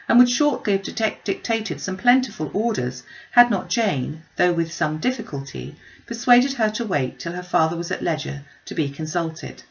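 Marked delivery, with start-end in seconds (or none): none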